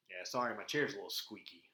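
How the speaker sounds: ordinary delivery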